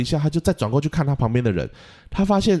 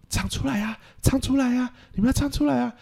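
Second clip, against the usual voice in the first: much higher, pleading voice; a panicked conductor pleading, rising higher; desperate urging. Retold or imitated. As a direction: beg the kids to sing out. Conductor urges silent choir.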